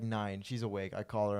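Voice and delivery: ordinary talk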